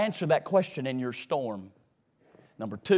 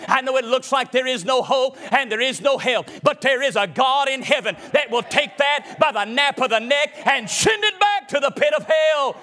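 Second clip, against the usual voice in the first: high, driving revival preacher delivery; full-force preaching; 7 semitones higher, 4 dB louder than usual. Acted. Preacher to congregation about God in the storm, full-force preaching. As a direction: preach it hard in rolling, repeated phrases.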